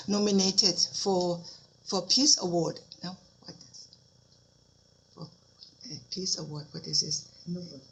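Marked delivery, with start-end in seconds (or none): 3.0-7.8 whispering to herself. Real, not acted. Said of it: "muttering to herself recalling".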